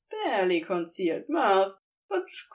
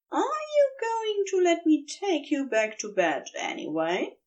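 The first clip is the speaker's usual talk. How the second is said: a lightly voiced, higher playful angel; teasing angel; 7 semitones higher than usual. A narrator's reading. Angel flattering his sulking demon lover, lightly voiced higher tease. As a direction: tease him with mock helplessness.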